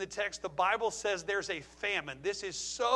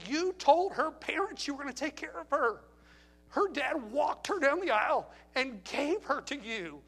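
higher, slow, earnest plea to a friend; pleading pastor; 5 semitones higher, 3 dB louder, slower than usual. Retold or imitated. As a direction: beg him not to wreck his marriage. Pastor pleading with cheating husband.

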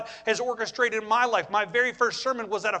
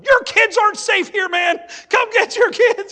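much higher, much louder desperate shout; scream; 11 semitones higher, 9 dB louder than usual. Retold or imitated. Houseparent pleads with the father on the phone, shouting. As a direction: yell it down the phone. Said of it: "I wanted to scream in that telephone".

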